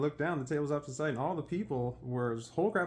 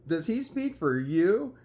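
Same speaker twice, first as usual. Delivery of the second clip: slow, higher, pointed question; retold challenge; 4 semitones higher, 4 dB louder, slower than usual. Challenging the buddy about his leader, retold pointed question. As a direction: spell out the challenge slowly.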